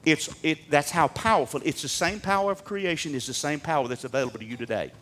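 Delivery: normal talking voice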